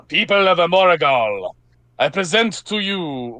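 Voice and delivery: put-on a knight proclaiming in a fumbled Russian accent; heralding the knight in a shaky Russian accent; attempted Russian accent. Herald announces the flame knight, in a wobbly Russian accent. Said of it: "is this the Russian accent?".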